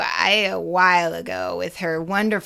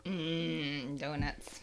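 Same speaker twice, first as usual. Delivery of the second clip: dreamy craving; 6 semitones lower, 15 dB quieter, much slower than usual. Acted. Dreamy echo of donuts.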